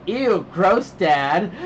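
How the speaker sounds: mock a kid's disgust; disgusted child imitation. Dad retelling his child's reaction, light mock disgust.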